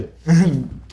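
quick high laugh; short laugh. Real, not acted. Laugh after a joke.

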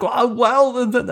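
high, loud, stammering hedge; imitated floundering excuse. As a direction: imitate someone caught out and stalling.

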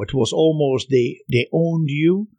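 normal talking voice

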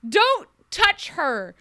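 high, loud, drawn-out yell; hammed-up slow scream. Retelling Belle's scream across the distance, slow and loud. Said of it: "Belle then screams".

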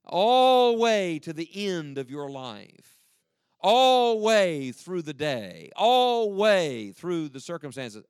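slow, loud, stressed All way; emphatic preaching. Acted. Preacher drives home always to congregation, slow and loud. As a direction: pound out each All way slowly.

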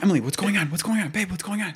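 confused boyfriend calling to his partner in the dark, retold with a worried rising pitch. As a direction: call to her in the dark, alarmed. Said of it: "So I'm like".

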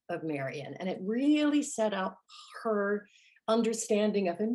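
normal talking voice

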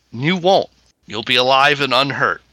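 ordinary delivery

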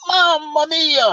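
high, drawn-out imitated Italian exclamation; mock-amazed Italian cry. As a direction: stretch it out high like an astonished Italian. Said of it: "And he went".

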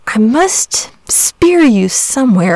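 drawn-out pondering; thoughtful. A narrator's reading. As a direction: think it out slowly as you speak. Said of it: "said Saint George thoughtfully".

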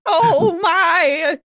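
put-on high, loud flustered old lady; old lady gasp. Old lady panicking in the skidding car. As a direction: clutch the pearls and gasp. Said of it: "in our old lady voices".

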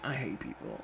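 low, very quiet mock mutter; muttered aside. Clerk turning to a friend after greeting customers, muttered aside. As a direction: drop it under your breath. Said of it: "they turn to the friend".

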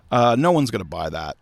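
ordinary delivery

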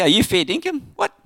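high, loud mock Aussie question; imitating folks back home hearing Aussie slang; Australian accent. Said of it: "They go".

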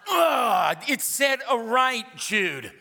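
hammed-up backseat-driver exasperation; exasperated wife. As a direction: imitate a wife groaning then scolding. Exasperated wife correcting his turn.

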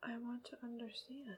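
breathy whisper. Real, not acted. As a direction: whisper it to yourself. Whispers, baffled.

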